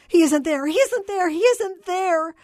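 high, loud, anguished wail; tortured cry. A narrator's reading. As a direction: cry it out in torment, drawing out the last one. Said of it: "the tortured voice cried".

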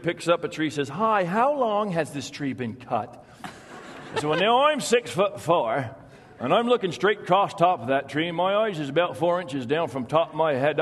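put-on storyteller Vermont accent, unhurried and dry; rural Vermont logger accent. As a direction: tell it like an old Vermont logger spinning a yarn. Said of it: "you've got the accent down".

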